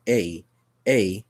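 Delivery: A said as the letter name, ay; long vowel A, ay. Shown as a demonstration. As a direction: stress the A like the alphabet letter. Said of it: "sounds like how you would say the letter A".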